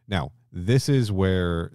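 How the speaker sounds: ordinary talk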